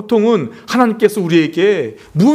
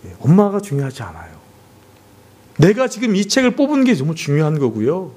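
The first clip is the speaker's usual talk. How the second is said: ordinary delivery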